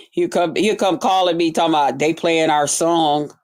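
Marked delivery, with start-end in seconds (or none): none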